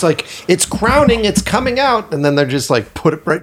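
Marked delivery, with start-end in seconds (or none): 0.5-2.1 much higher, hammed-up excitement; mock excited birth announcement. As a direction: squeal it like a delivery-room announcement.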